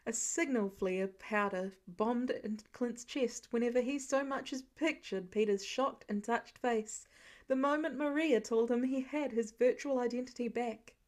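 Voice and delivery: usual speaking voice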